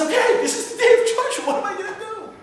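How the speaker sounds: high-pitched, animated voice for God; God wondering aloud. Acted. God faces judgment day, asking what to do, animated.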